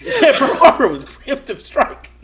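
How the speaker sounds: high, loud, laughing through the words; can't hold back the laughter. Real, not acted. Cracking up at the joke, laughing high and loud. Joking about Pearl Harbor comparison.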